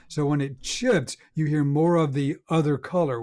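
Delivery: ordinary delivery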